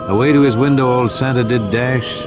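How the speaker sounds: usual speaking voice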